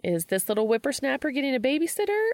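put-on high, quick teasing voice to a toddler; cooing at a little kid, fast; playful Charlie. Charlie teases the child.

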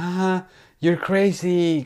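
high, drawn-out mock female voice; imitated young woman. Man retelling a woman's reply to a stranger on the train, imitated female voice. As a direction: stretch it out high, playing the woman.

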